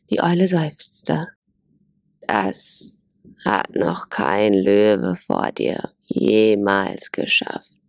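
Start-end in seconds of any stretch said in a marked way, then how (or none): 2.2-7.8 put-on much lower, slow, weary old owl. A narrator's reading. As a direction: sigh it out like a tired old owl. Old owl answers after sighing.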